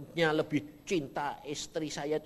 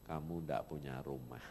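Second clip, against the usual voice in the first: lower, softer, grave pronouncement; voice of God; 11 semitones lower, 9 dB quieter than usual. Retold or imitated. God telling the preacher he has no house, low and grave. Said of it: "Tuhan ngomongnya apa?".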